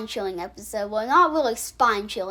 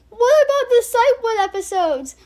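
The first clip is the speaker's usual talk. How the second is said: high, loud whiny viewer voice; mock viewer whine; 10 semitones higher, 6 dB louder than usual. Mocking a viewer who asks about missing episodes, high whiny squeal. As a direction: squeal it like a nagging commenter. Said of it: "you might be saying".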